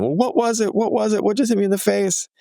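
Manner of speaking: put-on high, frantic kid's voice; imitated panicked questioning. Upset daughter to dad after being hit, mimicked frantic questioning. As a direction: mimic a daughter demanding answers in a high pitch.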